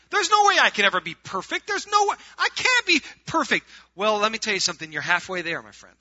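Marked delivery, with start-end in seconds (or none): 0.1-3.7 high, loud protest of someone giving up; mock despair. Preacher voicing a listener who realizes the standard is impossible, mock despair. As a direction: cry out that it is hopeless, pitched way up.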